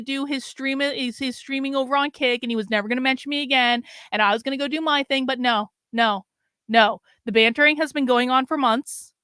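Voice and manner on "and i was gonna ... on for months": normal talking voice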